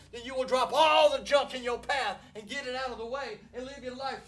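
loud, high preaching voice; fiery preaching. Acted. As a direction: preach it out to the church with fire. Preaching at full force.

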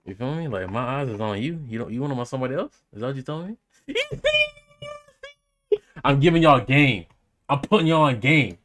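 ordinary talk

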